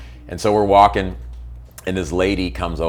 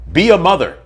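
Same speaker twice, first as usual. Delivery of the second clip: higher and louder, scolding; heated outburst; 9 semitones higher, 7 dB louder than usual. Real, not acted. Venting on a podcast about the woman with the baby, louder. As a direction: demand it of her.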